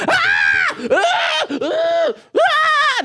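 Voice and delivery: high mock screams of panic. Acting out his crew fleeing from prep, mock screaming. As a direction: shriek like someone running away. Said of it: "Until I said".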